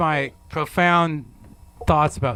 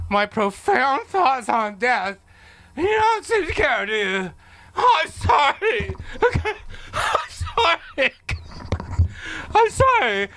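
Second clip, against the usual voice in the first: high, wailing hammed-up sobs of sorry; sobbing breakdown; 14 semitones higher than usual. Host breaking down on air to his guests, wailing hammed-up sobs. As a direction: blubber apologies, voice cracking way up with laugh-sobs.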